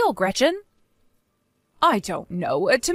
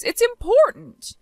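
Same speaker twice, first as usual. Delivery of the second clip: much higher, louder and slower; high emphatic outburst; 12 semitones higher, 4 dB louder, slower than usual. Acted. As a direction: hammer home that it matters. Mother-in-law defends her business trip.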